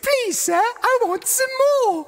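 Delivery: very high pleading British urchin; hammed-up orphan plea in British accent; Oliver Twist. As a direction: beg in a squeaky mock-British child voice. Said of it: "Oliver Twist".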